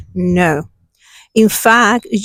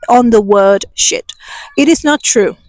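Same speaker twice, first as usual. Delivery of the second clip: ordinary talk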